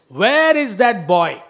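slow, loud demand; demanding shout. Retold or imitated. Kamsa demanding the missing boy, loud and slow. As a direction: bellow the question at Devaki.

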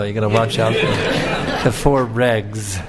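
slow, mocking take on the slang four regs, with a laugh; amused mock of the phrase four regs; mocking lingo. Shown as a demonstration. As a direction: parody the jargon four regs, drawn out and amused. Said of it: "this is iskon lingo".

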